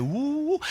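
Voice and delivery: high, soft rising whoop; retold wow of amazement, sliding up; astonished "woo". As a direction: imitate someone amazed by the distance. Retells driver's astonished reaction.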